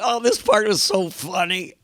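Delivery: high, laughing and gleeful; giggling delight. Retold or imitated. Linda Blair watching her own horror film, giggling delight. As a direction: giggle through it like a fan of the scene. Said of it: "She's going".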